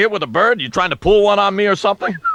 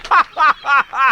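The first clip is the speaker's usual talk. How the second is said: mock bird laughter; 8 semitones higher than usual. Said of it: "everybody's going".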